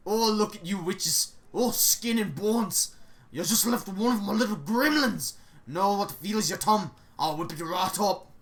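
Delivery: put-on loud, doting old cook voice; fussing cook. Cook fussing over the skinny visiting witches, loud and doting. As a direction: coo over them like a busy kitchen matron.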